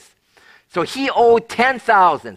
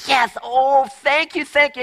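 lightly voiced thrilled servant, higher; excited gratitude; 6 semitones higher than usual. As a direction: gush your thanks like a forgiven debtor. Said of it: "he's, he's incredibly excited".

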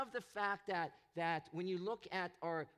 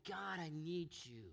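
much softer and lower, a broken cry to God; desperate plea; 7 semitones lower, 6 dB quieter than usual. Retold or imitated. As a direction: pray it quietly from the bottom of the pit. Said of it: "he's going".

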